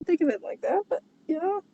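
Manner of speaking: high silly voice. Acted. High put-on voice.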